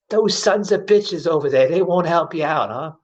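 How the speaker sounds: a lightly voiced indignant old lady. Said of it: "She's like".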